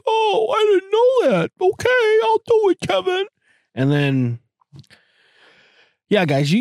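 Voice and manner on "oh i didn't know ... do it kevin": very high falsetto woman; hammed-up falsetto female impression; imagined woman's voice. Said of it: "she'd be like".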